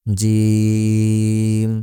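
জিম with the vowel held very long and level; long-drawn জিম. Shown as a demonstration. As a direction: stretch the middle of জিম on one note. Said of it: "তিন আলিফ পরিমাণ টান". (জিম লম্বা টেনে)

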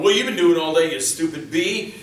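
loud, escalating mock marital fight; acted arguing. Couple fighting over money, hammed-up yelling. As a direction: start loud, climb to a high yelled comeback. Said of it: "they're arguing and fighting".

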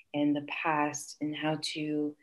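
ordinary delivery

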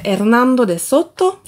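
playful mock Spanish accent; deliberately bad Spanish accent. Book vlogger naming a conquistador for fun, bad Spanish accent. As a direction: have fun mangling the name in Spanish. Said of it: "ça m'amuse de parler mal espagnol".

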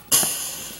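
a sudden breathy gasp of shock; sharp gasp. Acted. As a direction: gasp as if startled. Shock at what happened.

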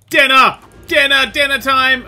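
high, loud gleeful dinner call; hunting shout. Pretend. Player charges a parasaur as food, gleeful shout. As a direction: holler it as you charge the prey.

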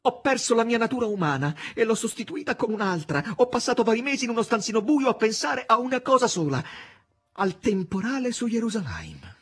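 high, pained woman's voice; Margherita, hurt and bitter. Acted. Margherita reproaching the master, high and pained. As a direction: reproach him, near tears.

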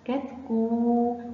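short first syllable, long held oo in كتكو. Shown as a demonstration. Teacher builds the word for children, long oo in كتكو. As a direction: slowly stretch the end of كتكو. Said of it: "مد بالواو".

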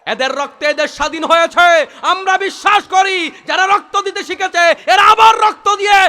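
loud, high, rousing sermon delivery; preaching at full force. Acted. Preacher rousing the waz crowd to victory, shouting. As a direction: hammer each line at the crowd.

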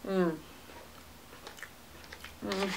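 usual speaking voice

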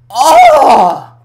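sudden high loud oh; high pained cry. Real, not acted. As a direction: cry out from the burn. Sour candy sting.

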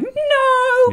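high, loud inner warning voice; gut voice. Pretend. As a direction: squeal it like a panicked gut. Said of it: "your gut is just like".

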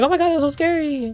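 high, fast, loud panicked squeal; hammed-up frightened babble; mock scared screaming. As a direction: imitate yourself shrieking after a scare. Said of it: "I'll scream like".

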